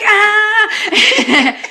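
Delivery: high, loud scream with laughter; hammed-up high "ah" scream; mock scream. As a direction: let out a comic overwhelmed cry. Acts own overwhelmed reaction.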